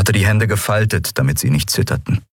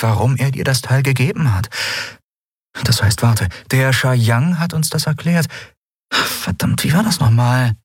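a hint of a puzzled woman, raised pitch; thinking aloud, lightly in character; lightly voiced Lien; 6 semitones higher than usual. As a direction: lightly act someone trying to remember. Narrator voices Lien in dialogue.

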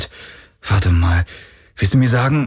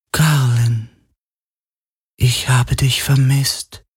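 ferne Geisterstimme; 6 semitones higher, slower than usual. A narrator's reading. Stimme der Helix erinnert.

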